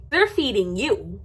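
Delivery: ordinary delivery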